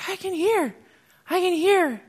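a deaf woman suddenly hearing, crying out in joy; joyful cry. Retold or imitated. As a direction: burst out with it, amazed. Deaf woman realizes she hears.

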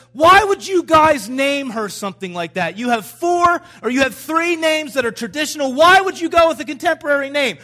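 loud, high-pitched retold objection; imitated disapproving mother. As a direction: play an indignant mom challenging the choice. Mother objecting to baby name.

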